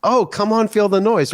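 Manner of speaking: clueless friend. Retold or imitated. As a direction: play the friend sure they got it. Friend guesses wrong song.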